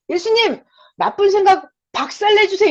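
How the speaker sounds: high, loud pleading cry; fervent loud prayer. Shown as a demonstration. Preacher demonstrating a prayer against bad thoughts, fervent shout. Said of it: "이렇게 말을 확 해버리는 거예요".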